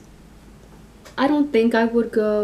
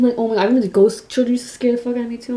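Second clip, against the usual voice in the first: ordinary talk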